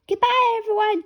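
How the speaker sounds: little pig cheering goodbye; cheerful goodbye. Acted. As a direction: sing out the goodbye. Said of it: "cheers Peppa".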